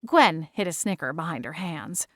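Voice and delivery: usual speaking voice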